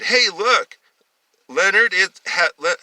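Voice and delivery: loud, high-pitched taunt; teasing call. A narrator's reading. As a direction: call out mockingly across to someone. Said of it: "Tony and Bill were teasing Leonard".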